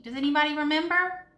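usual speaking voice

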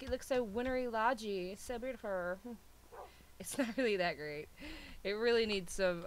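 usual speaking voice

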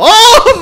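loud, high cry of alarm; startled yell. Real, not acted. Player caught off guard mid-joke, startled yell. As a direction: yelp out in sudden fright. Sudden fright while mocking dirty laundry.

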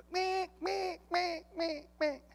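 high, soft meek meek of a duck call. Retold or imitated. Hunting guide calling in the ducks, high and soft. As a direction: imitate a duck call softly. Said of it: "this guy goes".

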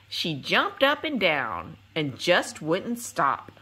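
lilting storybook read-aloud with singsong refrains; reading to children. Acted. Narrator reading a rhyming picture book to young viewers, lilting and gentle. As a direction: read it like bedtime story time for little ones.

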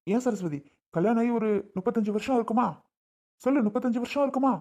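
high, heated questioning; angry demand. Acted. Husband demanding his wife admit thirty-five years together, heated and high. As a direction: press her hard for an answer.

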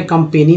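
कंपेनी with 'ay', kum-pay-nee; wrong 'ay' vowel. Shown as a demonstration. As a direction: put an 'ay' in the middle of कंपेनी. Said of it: "नहीं बोल रहे".

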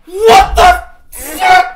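high, drawn-out disbelief; shocked. Real, not acted. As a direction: squeak it out stunned. Disbelief at donations.

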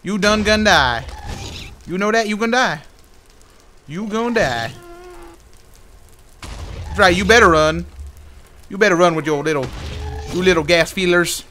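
mocking taunt. Real, not acted. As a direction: rub it in as he runs off. Keeps taunting the fleeing mob.

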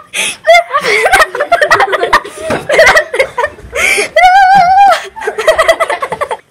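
loud shrieking laughter; laughing. Real, not acted. Big laugh after impression.

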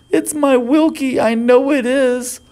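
high, anxious mother voice; worried mother. Acted. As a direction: plead with motherly worry. Mother fearing for son.

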